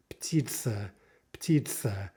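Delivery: "Ptitsa" with P running straight into T; P-T cluster. Shown as a demonstration. Teacher showing Russian word for bird, tight P-T cluster. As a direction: say "Ptitsa" with no vowel after P. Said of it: "P with T".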